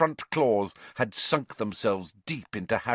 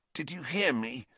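weak; 3 semitones higher, 6 dB quieter than usual. A narrator's reading. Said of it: "Ron said weakly".

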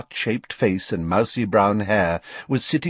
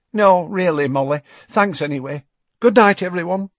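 higher, hurried polite refusal; Tonks declining; 9 semitones higher, 4 dB louder than usual. A narrator's reading. Tonks turns down Molly's invitation on her way out, higher and hurried. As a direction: decline and slip away.

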